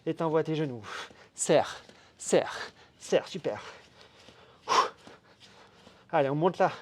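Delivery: pumped-up coach calling out reps; loud workout coaching. Real, not acted. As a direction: drive them hard, loud and energetic. Coach pushes class at full volume.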